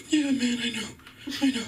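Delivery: high-pitched, mock-sad friend; sad buddy. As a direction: imitate a guilty buddy giving in. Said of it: "is really sad because he's cheating".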